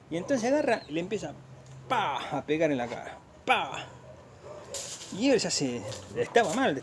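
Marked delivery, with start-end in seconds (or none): 1.9-2.2 drawn-out "pah" of a blow; storyteller's spoken smack sound effect; imitated punch sound. 3.4-3.9 drawn-out "pah" of a blow; storyteller's spoken smack sound effect; imitated punch sound.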